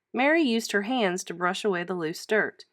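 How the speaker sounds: plain reading voice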